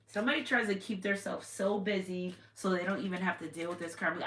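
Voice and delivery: ordinary delivery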